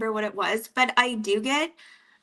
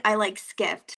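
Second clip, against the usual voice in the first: usual speaking voice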